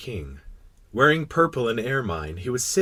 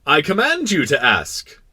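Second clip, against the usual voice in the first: loud, slow, higher order; commanding king; 6 semitones higher, 7 dB louder than usual. Acted. King hastening to order the question, commanding. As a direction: issue permission as a decree. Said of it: "the king hastened to say".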